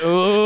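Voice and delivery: loud, high, drawn-out crowd "oooh"; class "ooh". Retold or imitated. Insisting the class really did this, loud teasing. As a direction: imitate a whole classroom reacting to trouble. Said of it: "people legitimately went".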